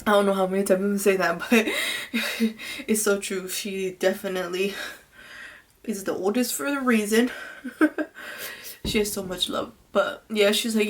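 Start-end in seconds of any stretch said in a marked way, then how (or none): 1.7-2.8 brief high chuckle; short real laugh, pitched up. Real, not acted. Laughs at repeating herself. 7.3-8.8 soft, high, breath-filled laugh; quiet high giggle. Real, not acted. Laughs while praising her sister.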